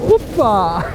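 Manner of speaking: high, loud whoop; real exclamation. Real, not acted. Skater in the middle of a stunt, whooping loudly. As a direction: let out a sudden, high-pitched whoop. Exclamation during skating action.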